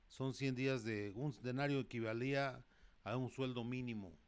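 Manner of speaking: ordinary talk, nothing marked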